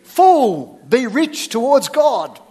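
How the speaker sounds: forceful, slow impression of a TV character; Mister T. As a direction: belt it out like a catchphrase, slowing after the first word. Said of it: "Mister T".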